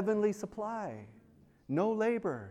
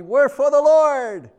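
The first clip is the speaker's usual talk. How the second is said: joyful cry; 9 semitones higher, 11 dB louder, slower than usual. Retold or imitated. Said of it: "And they're so happy".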